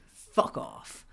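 low, breathy deadpan swear; lightly voiced Succession-style dismissal; Succession fuck off. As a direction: toss it off like a TV drama line. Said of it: "throw in a succession "fuck off".

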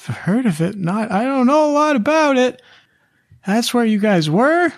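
very high, squeaky little halfling voice; halfling Amelia. Acted. GM voicing the halfling urging the party inside, high and squeaky. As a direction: squeak it out in a tiny urgent voice. Amelia answers about Dominia.

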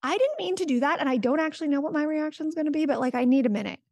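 high, quick flustered admission; caught-out parent. Retold or imitated. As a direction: blurt the apology like a parent catching themselves. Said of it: "you go".

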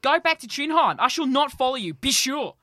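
loud, high furious roar; roaring rage. Acted. Njord dismissing his wife, furious roar. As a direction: roar him off, beside himself. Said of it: "roared Njord, beside himself with rage".

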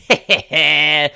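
short, quick giggling chuckle; real staccato snicker; amused chuckle. Real, not acted. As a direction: chuckle briskly at the punchline. Laughs at the AstroTurf quote.

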